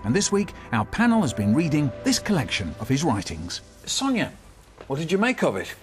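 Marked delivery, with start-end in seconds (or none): none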